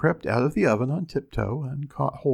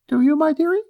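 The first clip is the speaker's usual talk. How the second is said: very high-pitched, louder wife voice; ogre's wife; 6 dB louder, faster than usual. A narrator's reading. Ogre's wife answering her husband, high piping voice. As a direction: pipe it up high above the narration. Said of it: "says the ogre's wife".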